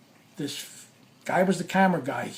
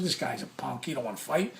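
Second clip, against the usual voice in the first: softer, lower muttered verdict to himself; inner thought; 5 semitones lower, 10 dB quieter than usual. Retold or imitated. Thinking to himself about the cameraman, soft, low and scornful. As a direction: sneer it quietly under your breath. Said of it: "I said to myself inside".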